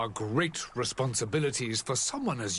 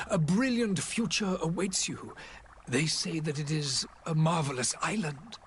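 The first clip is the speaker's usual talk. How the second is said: ordinary delivery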